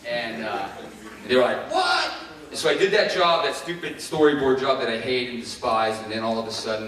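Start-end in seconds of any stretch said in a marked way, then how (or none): 1.7-2.1 high, loud; incredulous yelp from the bosses; mock shock. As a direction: squawk it. Coworkers react to request.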